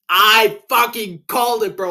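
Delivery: loud, high shout of triumph; triumphant shout. Real, not acted. Vlogger to his viewers, celebrating a correct fight pick, loud triumphant shout. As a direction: yell it like you just won the bet.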